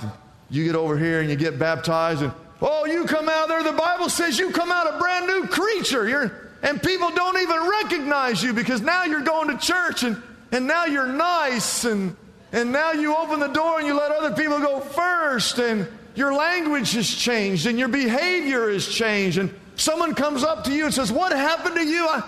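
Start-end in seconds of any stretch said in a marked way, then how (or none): 2.6-21.1 high, sustained preacher's excitement; exuberant preaching. Real, not acted. Preacher builds up the changed life. 21.1-22.2 high, incredulous acted questioning; amazed disbelief. Acts old schoolmate amazed at change.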